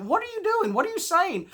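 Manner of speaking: high, loud startled questions; shocked parents. Retold or imitated. Parents catching kid cursing, high shocked questions. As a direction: gasp it out in disbelief. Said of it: "And we're like".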